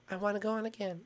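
low, soft little request; play-acted meek child begging; subdued plea. Child sulkily repeats the plea.

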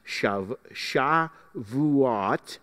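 Shavuot sounded out slowly, syllable by syllable; careful pronunciation. Shown as a demonstration. Teacher showing the class how Shavuot is pronounced, carefully sounded out. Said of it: "with the emphasis on the third syllable".

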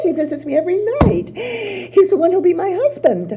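high, fast, excited boasting; giddy rush. Acted. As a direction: spill it out in a happy rush. Psyche rushing proud claims.

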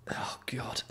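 whispered oh God; whispered dismay. Real, not acted. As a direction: breathe it out in despair. Dismay at product.

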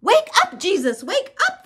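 high, loud cry to wake someone; urgent high-pitched yell; screamed panic. A narrator's reading. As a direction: cry out in acted alarm. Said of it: "they screamed".

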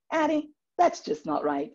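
high-pitched imitation of a Southern friend. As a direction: gently correct in a Southern accent. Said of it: "she tells me".

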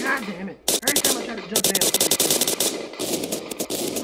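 loud frustrated outburst; frustrated yell. Real, not acted. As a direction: vent it loudly after dying. Killed again in game.

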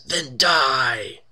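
loud, high battle cry; acted scream. A magic mouth dooms the intruders, acted scream. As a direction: shout a drawn-out death threat, hammed-up. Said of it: "The mouth screams".

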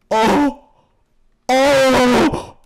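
loud, high hype call; loud excited cheering; hype yelling. Real, not acted. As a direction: cheer the kill like a caster. Hype over kill, loud.

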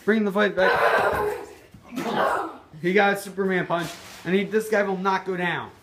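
lively ringside play-by-play; wrestling commentator. Acted. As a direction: call the match like a TV announcer.

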